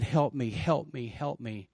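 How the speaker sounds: normal talking voice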